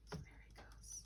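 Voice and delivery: very soft unvoiced whisper; whispered aside. Real, not acted. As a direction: murmur it to the listener.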